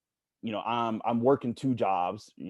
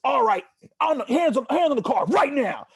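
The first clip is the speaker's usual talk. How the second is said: much higher, louder barked order; mock police command; 9 dB louder than usual. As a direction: imitate a cop yelling an order. Cop suddenly orders hands on car.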